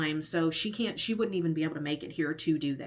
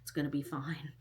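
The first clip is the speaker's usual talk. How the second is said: quiet, soft talking herself down; calming herself down under her breath; self-soothing; 4 semitones lower, 10 dB quieter than usual. Retold or imitated. Irritated patient quietly calming herself at the dentist. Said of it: "I was really irritated and was like".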